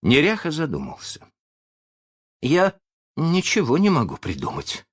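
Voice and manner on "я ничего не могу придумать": higher-pitched, rueful admission; regretful. A narrator's reading. Pirate gives up on the guessing, regretful. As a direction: admit defeat with regret. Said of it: "сказал он с сожалением".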